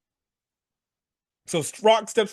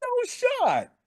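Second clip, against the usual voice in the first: high squeaky denial; high-pitched disbelief. Retold or imitated. Fan refusing to believe the storyline, squeaked in disbelief. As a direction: shoot the voice way up in disbelief. Recounting his disbelief while watching.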